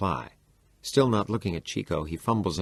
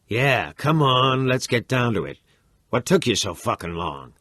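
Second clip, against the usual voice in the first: defiant challenge; 5 semitones higher, 5 dB louder than usual. Acted. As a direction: goad him, daring him to come on. Imagined taunt to his father.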